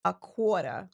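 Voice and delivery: New York accent with rounded vowels; dropped Rs, New York style. Shown as a demonstration. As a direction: say it like a New Yorker. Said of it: "and then you can say".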